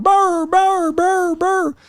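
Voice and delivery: high yappy dog barks; squeaky mock dog barking; imitating dogs. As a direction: bark like little dogs.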